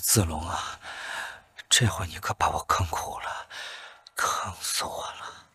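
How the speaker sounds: low, soft, tearful whisper; whispered lament. Acted. Prisoner whimpers a lament to Zilong in a whisper.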